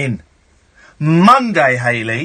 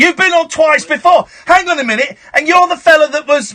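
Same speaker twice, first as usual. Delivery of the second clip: high, raised-voice scolding; indignant and overriding; exasperated accusation; 9 semitones higher, 3 dB louder than usual. Real, not acted. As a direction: tell off a repeat caller. Host accuses repeat caller.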